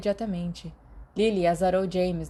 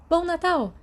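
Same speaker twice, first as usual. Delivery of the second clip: put-on bright girl's greeting; 11 semitones higher, 5 dB louder than usual. Lily greeting, cheerful question.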